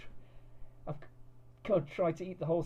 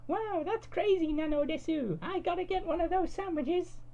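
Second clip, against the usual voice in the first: wide-eyed cartoon girl, pitched way up; cutesy anime girl. A narrator's reading. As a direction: girlfriend amazed, in a high squeaky anime voice. Cud's figure voice held.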